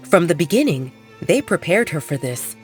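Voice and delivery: plain reading voice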